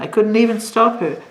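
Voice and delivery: ordinary delivery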